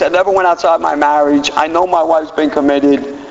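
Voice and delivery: ordinary talk, nothing marked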